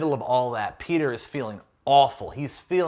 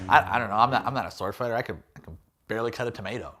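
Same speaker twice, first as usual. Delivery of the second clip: quick, lower, trailing mumble; mumbled self-mocking aside; rushed aside; 5 semitones lower, 4 dB quieter, much faster than usual. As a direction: throw it away under your breath.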